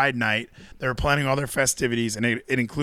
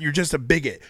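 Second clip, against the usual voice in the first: usual speaking voice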